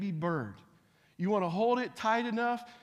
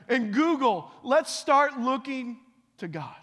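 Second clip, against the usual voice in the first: loud, emphatic preacher's call; fiery preaching; preaching at full force; 7 semitones higher, 7 dB louder, slower than usual. Acted. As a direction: drive each phrase home to the congregation. Preaching opener.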